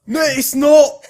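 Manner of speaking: loud, high, slow Scots; Scottish accent. Acted. Co-host denying his accent slipped, loud Scottish protest. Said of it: "It's turning into something else".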